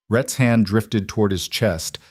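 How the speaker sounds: ordinary delivery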